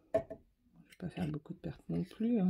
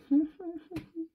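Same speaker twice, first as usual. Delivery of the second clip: amused high laugh; 9 semitones higher than usual. Real, not acted. Laughing at her own mess.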